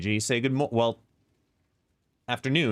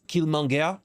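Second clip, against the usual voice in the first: put-on French accent Killmonger; 4 semitones higher than usual. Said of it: "said in a French accent".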